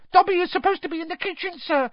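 fast, very high elf squeal; high-pitched gabbling elf; squealing Dobby. A narrator's reading. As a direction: squeal in a hurry. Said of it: "Dobby squealed".

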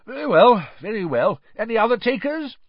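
high, loud jovial bookmaker; hearty call for takers; recovering brightly. A narrator's reading. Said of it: "but recovered himself".

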